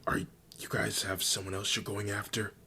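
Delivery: hushed, conspiratorial character voice; lightly acted stage whisper. A narrator's reading. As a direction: ask it in a half-whisper, prying. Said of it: "She whispers".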